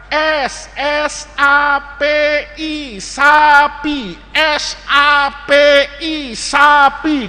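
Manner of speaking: slow, drawn-out letter-by-letter chant; first-grade spelling. Acted. First graders learning to write, slow spelling chant. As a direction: spell it out slowly like a class. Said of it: "belajar nulis".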